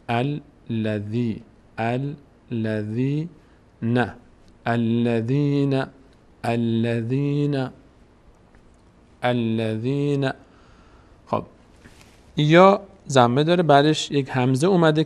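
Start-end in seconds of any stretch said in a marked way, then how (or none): 0.1-10.3 very slow "الذین" with a long ی; syllable-by-syllable recitation. Shown as a demonstration. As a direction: draw out الذین as al-la-dhiii-na. (تلاوت شمرده و کشیدهٔ «الذین»)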